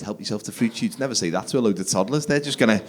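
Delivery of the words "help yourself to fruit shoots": low, very soft casual offer; nonchalant. Retold or imitated. Casual word to a load of toddlers, nonchalant. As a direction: say it like a passing remark, barely projected. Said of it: "Just a nonchalant comment".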